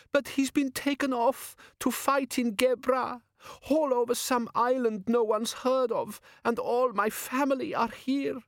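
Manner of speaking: high, drawn-out acted sob; sobbing. A narrator's reading. Crying girl defending her betrothed, sobbing. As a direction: wail it slowly through mock tears. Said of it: "she sobbed".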